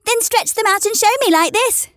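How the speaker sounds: high, lively challenge; wild rabbit daring. A narrator's reading. Wild rabbit dares the toy rabbit, high and lively. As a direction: show off while dancing. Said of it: "said the wild rabbit".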